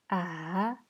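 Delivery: "A" dipping, then rising; third tone. Shown as a demonstration. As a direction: Mandarin third tone on "A". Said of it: "Les quatre tons".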